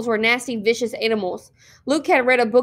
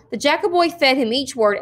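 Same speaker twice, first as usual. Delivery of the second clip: plain reading voice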